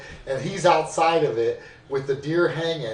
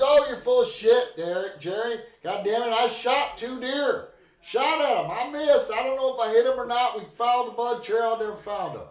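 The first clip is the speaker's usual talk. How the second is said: grandpa blustering; 8 semitones higher than usual. Retold or imitated. Grandpa denies missing to grandma, indignant. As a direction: huff like a caught-out old man swearing he shot two deer. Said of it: "And grandfather says".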